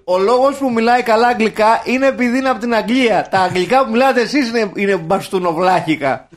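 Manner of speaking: loud, high-pitched tirade; mock indignant scolding. Telling off people over their bad English, mock indignant. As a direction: lay into them, loudly exasperated.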